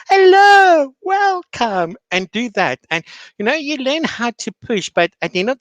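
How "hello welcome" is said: much higher and louder cheerful greeting; teacher greeting. Retold or imitated. Teacher greeting her students as the camera turns on, much higher excited voice. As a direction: switch on the bright online-teacher welcome. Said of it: "I'm like".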